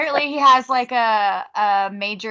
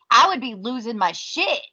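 usual speaking voice

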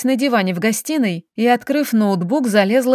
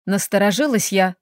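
usual speaking voice

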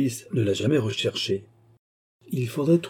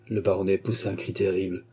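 plain reading voice

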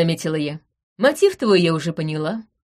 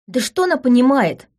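sudden indignant outburst, louder and higher; bursting out; 6 semitones higher, 3 dB louder than usual. Acted. Veron bursts out against the heiress, indignant. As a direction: blurt it out in exasperation. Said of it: "прорвала вдруг Верона".

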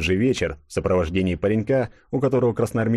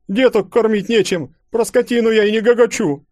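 high, loud indignant complaint; stout peasant woman; 6 dB louder than usual. A narrator's reading. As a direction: rail against the requisitions. (толстая баба возмущённо кричит)

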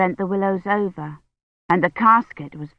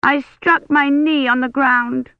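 put-on hurt child complaining; 7 semitones higher, slower than usual. Hurt little Agnes complains.

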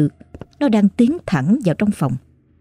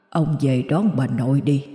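ghostly grandfather; 3 semitones lower, 6 dB quieter than usual. A narrator's reading. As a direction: speak like a dead grandfather whispering behind her. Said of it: "một giọng nói cất lên sau lưng tôi".

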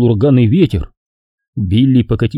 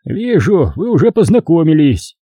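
put-on old man voice; 6 semitones higher than usual. A narrator's reading. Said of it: "сказал Гас, подмигивая".

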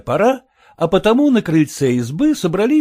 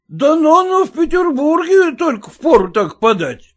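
shouting; 10 semitones higher than usual. A narrator's reading. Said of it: "кричал".

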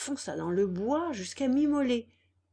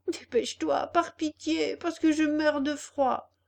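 put-on high, pleading voice for a freezing boy; pleading puppet; 7 semitones higher, 4 dB louder than usual. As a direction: beg to be let in, voice raised high.

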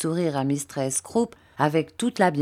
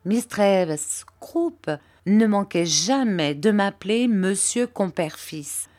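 ordinary talk

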